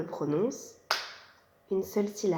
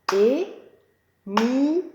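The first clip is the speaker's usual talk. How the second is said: É-mi-lie slowly, each beat louder and separate; syllables tapped out; 3 semitones higher, 6 dB louder, much slower than usual. Shown as a demonstration. Teacher clapping a pupil's name, slow and loud. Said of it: "Tapons les syllabes".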